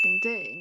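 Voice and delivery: loud drawn-out mock bell "ding"; alert chime. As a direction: imitate a fun-fact alert going off, loudly. Mimics a fun-fact alert sound.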